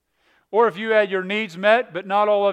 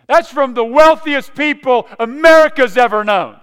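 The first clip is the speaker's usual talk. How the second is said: preaching at full force; 3 semitones higher, 9 dB louder than usual. Acted. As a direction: drive it home to the congregation.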